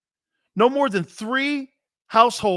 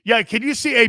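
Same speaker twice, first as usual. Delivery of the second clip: ordinary talk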